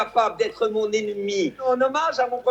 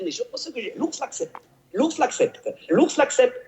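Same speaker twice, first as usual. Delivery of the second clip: Fabrice Luchini impression. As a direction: declaim like a hammy stage actor. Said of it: "celui qui déclame de façon extraordinaire cette fable".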